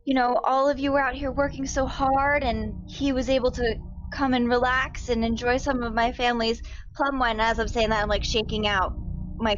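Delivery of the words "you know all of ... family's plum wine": higher, eager innocent tone; sweet apology. Acted. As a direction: charm the guards into believing you. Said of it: "address his coworkers".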